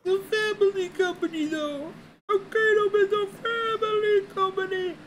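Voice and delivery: squeaky-high mocking impersonation; mocking imitation. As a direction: mimic them in an exaggerated, very high held tone. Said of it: "That's what you sound like".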